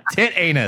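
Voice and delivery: "tit anus" as two separate words, tit then anus; mispronounced name. Shown as a demonstration. Host misreading a studio name for laughs, "tit anus" as two words. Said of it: "I think it's".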